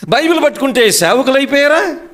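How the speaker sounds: high-pitched rhetorical questions; fervent, high-pitched pulpit questioning; preaching challenge. Real, not acted.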